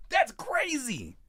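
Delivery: put-on high, slow little old lady. Reacting in a high elderly lady voice. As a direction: drawl it like a frail grandma. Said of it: "That sound like a".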